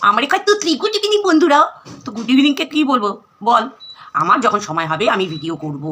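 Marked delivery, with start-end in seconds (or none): 0.1-1.6 high-pitched mock greeting; louder, high mimicry to ridicule someone; mocking imitation. Vlogger mimics rival greeting friends, mocking.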